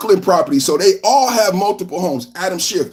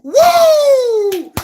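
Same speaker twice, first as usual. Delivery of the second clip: very high loud woo; excited whoop; 5 dB louder than usual. Real, not acted. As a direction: let out a whoop.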